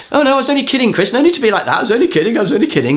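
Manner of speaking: very high, panicky mock pleading; cringing backpedal. Games player cringing back in his chair, high mock-frightened pleading. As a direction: squeal excuses like a cowering victim. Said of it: "he was leaning back in his chair going".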